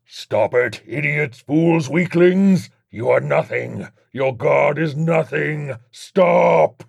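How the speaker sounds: high, enraged demon shriek; furious demon, rising to a scream; demonic shriek. A narrator's reading. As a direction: shriek insults at the exorcists. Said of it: "Legion shrieked".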